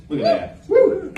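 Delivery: high whooping show-off; excited woo. Real, not acted. Comic showing off his new shirt to the crowd, high excited whoop. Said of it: "showing off his shirt".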